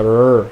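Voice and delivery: drawn-out growling "rrr"; growled R noise. Shown as a demonstration. Demonstrating the R sound to learners, growled. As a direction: growl a long English R. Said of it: "essa rosnada".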